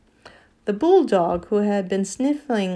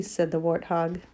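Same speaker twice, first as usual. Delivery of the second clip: ordinary delivery, nothing marked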